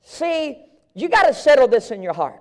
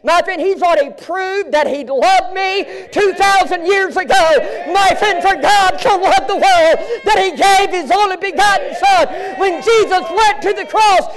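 shouted preaching climax; 6 semitones higher than usual. Acted. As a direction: proclaim it with everything you have. Preaching climax about the cross.

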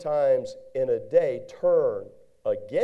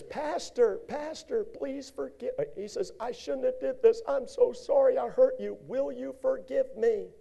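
high, pleading, tearful begging; a weeping man begging his pastor's forgiveness; crying repentant man; 10 semitones higher than usual. Acted. Sinner crying to the pastor, high pleading voice. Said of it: "He's crying".

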